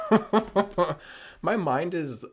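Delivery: laughing through the words; giggle, then slow down. Real, not acted. Laughs mid-thought.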